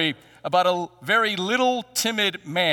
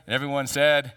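ordinary talk, nothing marked

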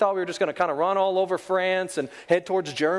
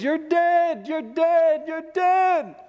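high frantic yell, repeated; screaming; 10 semitones higher, 3 dB louder, much slower than usual. Retold or imitated. Best friend screaming at the wounded soldier, frantic yell. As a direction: scream it in panic over a fallen friend. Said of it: "who is screaming at the top of his lungs".

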